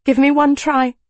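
desperate, urgent plea; begging. A narrator's reading. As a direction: beg for one chance. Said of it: "I begged".

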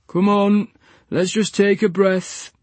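calm them down gently; gentle. A narrator's reading. Nurse soothing the patients, gentle. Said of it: "said Barney gently".

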